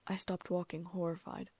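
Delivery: ordinary delivery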